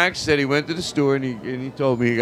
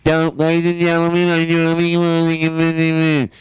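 loud, higher showman's pitch; comedian announcer voice; 4 semitones higher, 6 dB louder than usual. Acted. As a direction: belt it like a hack comic. Mock stand-up announcing to crowd.

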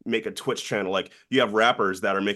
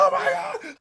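very high squeaky delight; excited squeal. Retold or imitated. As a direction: shriek it in disbelief. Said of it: "And I was like".

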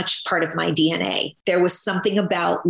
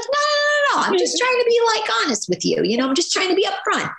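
high-pitched, insistent protest in character; channelled character voice; faster than usual. Retold or imitated. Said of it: "you can channel the voice".

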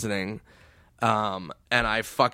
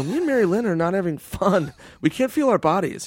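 higher-pitched mock distress; retold panicky complaint; 6 semitones higher than usual. Numb friend to the happy dancer, panicky complaint. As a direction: plead urgently, pitch raised.

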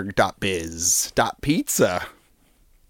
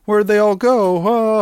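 Jimmy impression; 9 semitones higher, 3 dB louder than usual. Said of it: "he's gonna be like".

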